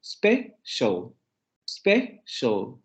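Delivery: special broken into spe, pause, shal; two syllables. Shown as a demonstration. Teacher repeating English syllable division, two separate syllables. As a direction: say special slowly in two beats, no E before it. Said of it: "solamente dos sílabas".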